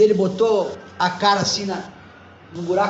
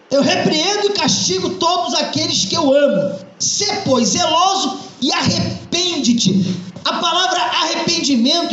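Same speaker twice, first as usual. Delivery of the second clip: raised, ringing pulpit voice; fervent preaching; 8 semitones higher than usual. Acted. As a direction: preach it to the congregation with conviction. Pastor preaches to congregation.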